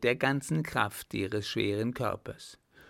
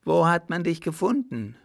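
higher, louder, pressing; eager urgent question; 6 semitones higher, 6 dB louder than usual. Acted. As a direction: demand the answer at once.

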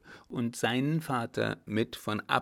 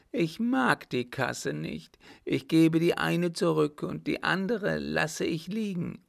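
anguished madman; 7 semitones higher, 4 dB louder than usual.

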